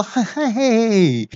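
high, very slow taunting heh-heh-heh; drawn-out mock laughter. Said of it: "就哈哈大笑".

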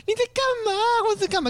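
high, startled mock "what are you doing"; mock shocked reply. Retelling a coworker's reply to a cockroach photo, mock shocked.

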